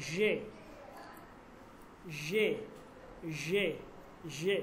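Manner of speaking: জে said as "zhe", like the middle of "vision"; teacher showing জে with an English "vision" zh; zh sound in জে. Shown as a demonstration. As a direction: model জে with a long zh at the start. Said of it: "উচ্চারণটা খেয়াল করেন".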